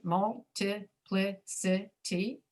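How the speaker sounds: "Multiplicity" very slowly, broken into separate syllables. Shown as a demonstration. Teacher pointing out the I's, "Multiplicity" slowly split up. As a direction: sound out "Multiplicity" one syllable at a time. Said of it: "look at all the I's in there".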